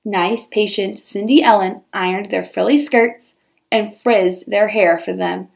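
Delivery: ordinary delivery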